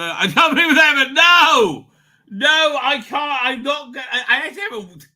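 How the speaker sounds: high, shouted refusal; loud protest. Real, not acted. Host refusing a chat request about AI proof, shouting. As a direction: cry out in protest, well above your talk.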